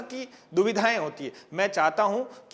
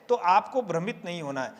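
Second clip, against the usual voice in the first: ordinary delivery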